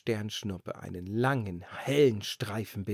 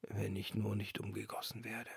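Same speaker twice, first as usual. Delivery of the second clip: much softer, trailing off; resigned lantern refrain; 2 semitones lower, 11 dB quieter than usual. A narrator's reading. The lantern whispering its fear to herself, softly. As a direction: murmur the old fear.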